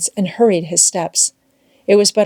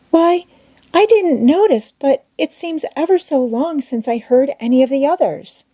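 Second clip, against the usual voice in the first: higher-voiced Becky, uneasy; 7 semitones higher than usual. A narrator's reading. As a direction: pitch up for Becky, a little worried.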